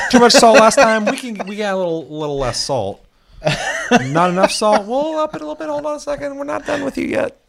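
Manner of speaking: high, playful chef serving a dish; mock chef voice. Voicing Ubisoft as a chef.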